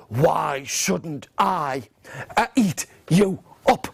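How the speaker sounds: very slow, menacing troll drawl; scary troll. Acted. Troll threatening baby goat, slow menace. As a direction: draw out each word like a storybook troll. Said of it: "up jumped the hairy, scary troll".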